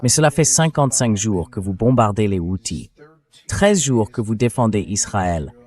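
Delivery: ordinary talk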